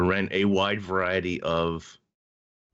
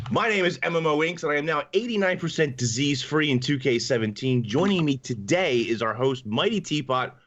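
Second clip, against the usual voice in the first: upbeat raised announcer delivery; cheesy DJ show intro, pitched up; local radio host voice; 3 semitones higher than usual. Acted. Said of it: "You also sound like a local radio host".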